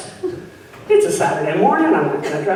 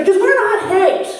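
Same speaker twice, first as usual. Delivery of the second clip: high, exasperated snap with a laugh; a touch of fed-up mommy; frustrated mom; 11 semitones higher than usual. Retold or imitated. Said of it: "in a frustrated mommy moment".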